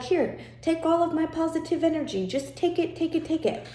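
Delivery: a lightly hammed-up pushover handing everything over; mock giving in. Coach mimics someone surrendering energy to a drainer, mock meek and high. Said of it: "you're like".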